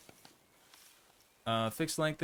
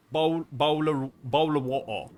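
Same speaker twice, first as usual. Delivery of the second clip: mock British accent; 5 dB louder than usual. Shown as a demonstration. Streamer teasing a British viewer, mock British accent. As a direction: drag out "Bowl" in a hammed-up British accent. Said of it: "We say".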